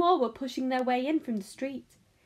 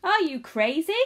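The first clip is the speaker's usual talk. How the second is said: loud, high incredulous woman; raised, indignant voice; shout; 5 semitones higher, 7 dB louder than usual. A narrator's reading. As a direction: burst out in disbelief. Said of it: "shouted a woman".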